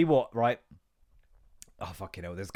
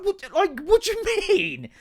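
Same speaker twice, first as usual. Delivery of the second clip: mock incredulous; 7 dB louder than usual. Mock reply to TikTok commenter.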